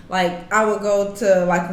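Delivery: usual speaking voice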